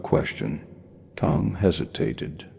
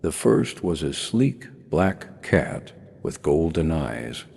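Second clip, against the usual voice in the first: usual speaking voice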